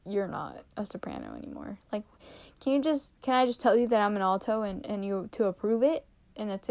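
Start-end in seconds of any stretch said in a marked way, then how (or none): 0.1-1.8 deep, quiet mock director voice; imagined choir director. To herself, imagining the choir director demoting her, in a deep, quiet mock voice. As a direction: drop way down, soft and stern, like the choir director. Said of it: "sing and be like".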